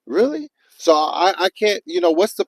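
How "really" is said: high, stunned "really?" of outrage; incredulous outburst. Retold or imitated. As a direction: pitch jumps much higher in disbelief. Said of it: "So I'm like".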